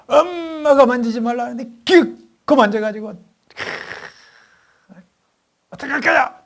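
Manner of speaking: put-on high, loud scolding of a naughty child; angry mother scolding. Mother scolds her three-year-old for the lipstick, angrily. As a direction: let the mother's anger burst out.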